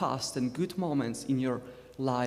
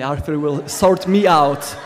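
louder, slower punchline; emphatic; 13 dB louder than usual. Real, not acted. Preacher to congregation, loud and drawn-out joke. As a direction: lean hard on the joke, drawing it out. Repeated joke about friend Arthur.

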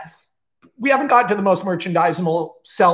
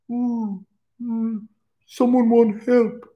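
a hint of slow, simple-minded grunting; lightly voiced Jason; 4 semitones higher, slower than usual. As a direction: mumble like a gentle brute. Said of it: "Jason is like".

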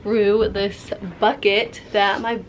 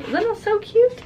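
gushing; 11 semitones higher than usual. Real, not acted. Gushing over painted gift.

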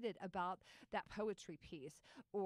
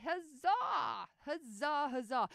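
hammed-up triumphant huzzah, higher and much louder; mock cheer; 6 semitones higher, 8 dB louder, much slower than usual. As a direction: cheer like a herald at a festival. Celebrating the book's birth.

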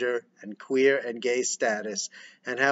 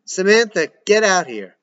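higher, loud order; commanding shout; 7 semitones higher, 8 dB louder than usual. Acted. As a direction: order her out. Father ordering daughter.

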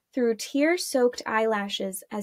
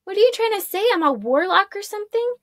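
high, loud incredulous retort; acted indignation, pitched way up; indignant challenge; 10 semitones higher, 6 dB louder, faster than usual. A narrator's reading. Arella challenges his claim.

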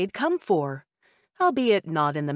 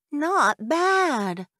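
a higher, put-on woman's voice, conceding; grudging older woman; 9 semitones higher than usual. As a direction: admit you were wrong about the nutmeg. Woman smacks lips, tasting.

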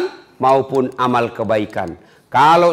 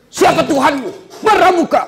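much higher, insistent angel voice; questioning angel; 13 semitones higher than usual. Acted. Angel interrogates the arguing dead man, high and insistent. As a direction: press the question, high and pushy.

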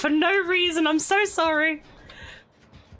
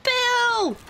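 calling out; 9 semitones higher than usual. Real, not acted. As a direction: shout the name up high. Calling out to teammate in game.

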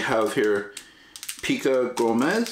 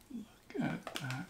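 whispering; 9 semitones higher, 15 dB quieter, much slower than usual. Real, not acted. Admiring candy up close.

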